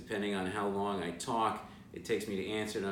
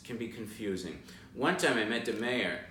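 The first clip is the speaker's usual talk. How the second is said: ordinary talk, nothing marked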